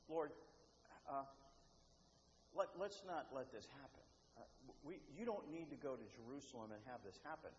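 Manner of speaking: hesitant objection. Retold or imitated. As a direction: quietly suggest it, hesitating between phrases. Peter timidly objecting to Jesus.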